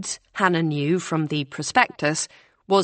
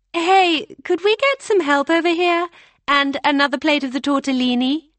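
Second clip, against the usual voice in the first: high, loud call across the room; 9 semitones higher, 4 dB louder than usual. Acted. April calls the catering staff over, high and loud. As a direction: hail the staff from your seat, higher and louder.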